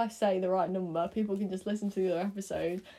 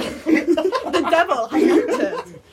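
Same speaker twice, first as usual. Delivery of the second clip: mock medieval villager; 4 semitones higher, 10 dB louder than usual. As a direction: cry out that the devil has come.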